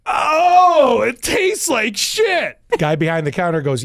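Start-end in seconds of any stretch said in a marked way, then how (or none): 0.1-2.6 loud, high, slow cry of disgust; disgusted shout. Acted. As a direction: yell it like he just tasted something foul. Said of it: "He's like".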